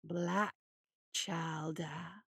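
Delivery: low, drawn-out monster voice; low slow menace; slow menacing hare. Acted. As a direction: stretch the name out slowly. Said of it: "Luther said slowly".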